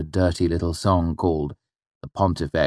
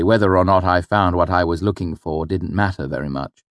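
ordinary delivery, nothing marked

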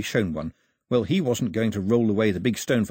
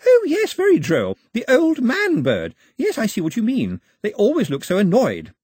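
much higher and louder, delighted; delighted young English girl; amused; 11 semitones higher, 6 dB louder than usual. A narrator's reading. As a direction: gush with amused excitement. Daphne amused after guessing.

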